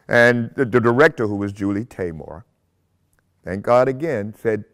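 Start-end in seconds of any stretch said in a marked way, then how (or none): none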